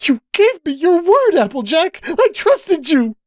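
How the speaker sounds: very high, hysterical accusation; hysterical pitch. A narrator's reading. Cupcake feeling betrayed by Applejack, high and hysterical. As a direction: voice rising into hysteria. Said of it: "her voice rose into a hysterical pitch".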